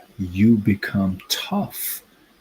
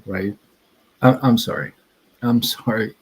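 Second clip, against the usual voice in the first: normal talking voice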